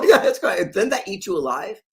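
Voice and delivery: high, loud laughing disbelief; incredulous laughing outburst. Retold or imitated. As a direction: laugh it out, can't believe it. Retold disbelief at lost billions.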